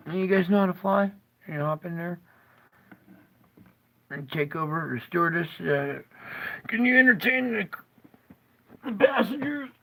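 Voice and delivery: lightly hammed pilot asking passengers to take over; mock airline captain. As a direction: play a goofy pilot bailing out. Joking pilot leaving the plane.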